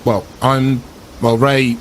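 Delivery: ordinary delivery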